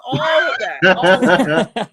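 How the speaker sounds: laughing through the words; cracking up mid-phrase. Real, not acted. Laughing at his own bi panic joke.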